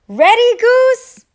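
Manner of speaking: high, loud eager call. A narrator's reading. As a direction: call out to Goose, all eagerness. Thistle calls to Goose.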